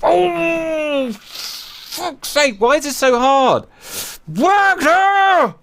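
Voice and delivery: high, exasperated ranting; furious at his own missiles; angry. Real, not acted. Commander raging that they hit their own carrier, angry high. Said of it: "God, I'm so angry right now".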